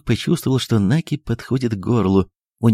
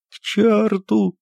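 high, slow, tearful cry; tearful shout; 11 semitones higher, much slower than usual. A narrator's reading. The doctor chases the guests off, crying out high and tearful. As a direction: cry out near tears. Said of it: "крикнул он плачущим голосом".

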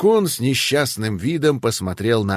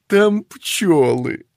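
laughing; 6 semitones higher, 3 dB louder, much slower than usual. Acted. Child quote, laughing.

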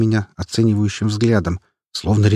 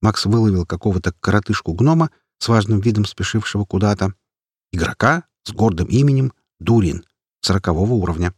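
ordinary delivery, nothing marked

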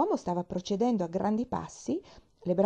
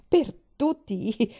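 slow, drawn-out, grumbling outburst; burst out; 5 semitones higher, 6 dB louder, much slower than usual. A narrator's reading. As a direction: blurt it out in exasperation. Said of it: "sbottò ancora".

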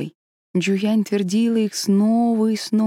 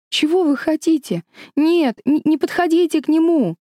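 high, loud panicked cry; frightened shout; 10 semitones higher, 4 dB louder than usual. Acted. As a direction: scream at the dragon in fear. Girl shouts at dragon. (испуганный крик)

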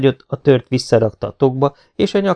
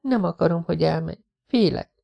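softer higher voice, scared; soft frightened plea; 6 semitones higher than usual. A narrator's reading. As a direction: confess fear quietly. (halk, félős vallomás)

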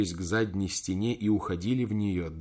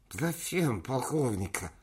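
put-on high, slow toothless old man; toothless mumble; 4 semitones higher, 3 dB quieter, slower than usual. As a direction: mumble through no teeth. Old chairman voiced.

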